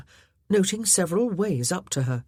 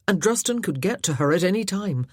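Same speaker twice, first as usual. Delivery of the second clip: ordinary delivery, nothing marked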